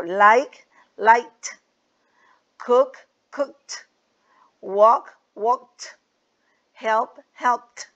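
like, liked, cook, cooked said with a crisp final T on each past form; teacher sounding out the final T in liked, cooked, walked, helped; past tense with T sound. Shown as a demonstration. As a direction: demo of -ed said as T, each word spoken clearly. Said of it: "suena como una T al final".

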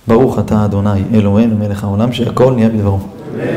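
low, subdued recitation; quick private prayer in a low register; murmured blessing. Real, not acted. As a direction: recite a real blessing to yourself, low and even. Says a blessing before drinking.

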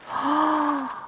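long, soft, high gasp; drawn-out intake of awe. Real, not acted. Awed by pink shimmer.

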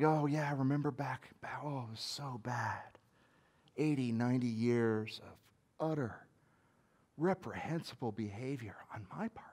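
fading from quiet to a whisper; hushed regret. Pretend. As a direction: confess it under your breath. Remembering past sins with shame.

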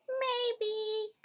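high, soft little mouse squeak; a small high voice piping up softly; tiny voice. A narrator's reading. Hidden mouse answering Bear from the hall, in a tiny voice. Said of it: "came a tiny voice".

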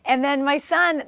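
ordinary talk, nothing marked

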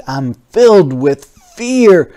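ordinary delivery

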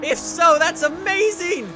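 high, loud burst of delight; thrilled amazement. Real, not acted. Player spotting an ejector seat button, thrilled amazement.

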